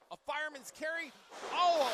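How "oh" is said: loud, high shout; excited shocked "oh". Real, not acted. Commentator watching a fireman's carry slam, shocked shout. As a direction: burst out at the impact. Reacting to a big move.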